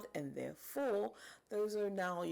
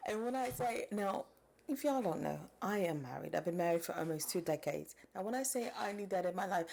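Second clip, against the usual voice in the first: ordinary talk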